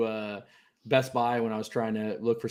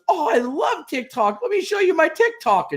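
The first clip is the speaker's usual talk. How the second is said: put-on much higher, slow excited old lady; 4 dB louder, slower than usual. Walmart lady showing her TikTok, high slow gushing. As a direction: gush like a thrilled little grandma.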